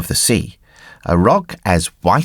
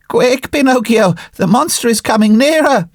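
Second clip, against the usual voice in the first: high, urgent pleading goat voice; 14 semitones higher than usual. Acted. Little goat on the rock urging Pinocchio on, high and pleading. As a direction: beg him to hurry in a high bleating plea.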